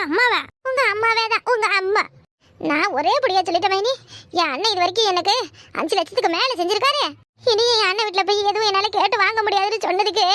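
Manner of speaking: usual speaking voice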